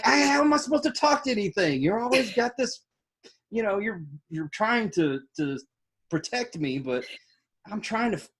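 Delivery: high-pitched, mock indignant protest; exasperated complaint. Husband protests his wife's protective bubble, high and exasperated. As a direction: whine in exaggerated frustration.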